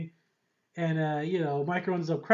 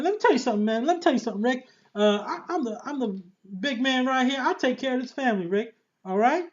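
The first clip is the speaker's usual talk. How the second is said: a lightly voiced swaggering Shane; a bad, half-hearted tough-guy imitation; Shane impression; 6 semitones higher, faster than usual. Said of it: "It was the worst Shane impression".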